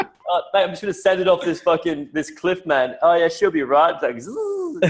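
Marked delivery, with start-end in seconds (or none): 0.0-3.9 higher, carefree reckless mate; reckless Aussie bloke. Retold or imitated. Worker about to drive off a cliff, hammed-up carefree Aussie. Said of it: "mining worker about to drive off cliff". 4.2-4.9 falling whistle. Retold or imitated. Imitating a falling sound.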